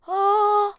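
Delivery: high operatic yelp; loud operatic cry. Real, not acted. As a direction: sing out a shrill high "oh". Calling out to the monster.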